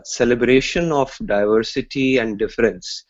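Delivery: ordinary talk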